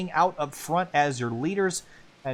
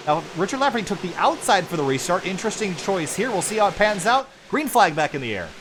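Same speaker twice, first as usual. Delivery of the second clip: rising, louder restart call; live play-by-play; 5 semitones higher, 3 dB louder than usual. Real, not acted. Race commentator calling the restart for viewers, lively play-by-play. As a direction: build the energy as the green flag drops.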